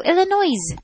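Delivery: Illinois high, with the final s sounded, "Illi-noise"; mock spelling pronunciation. Shown as a demonstration. Teasing an American host, Illinois said high as "Illi-noise". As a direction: say Illinois as spelt, ending like "noise". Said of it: "why don't you s-, pronounce it like that?".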